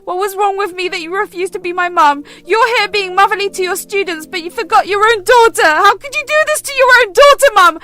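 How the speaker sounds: put-on hurt teenage daughter lashing out; angry accusation. Daughter accuses her mother of abandoning her, furious.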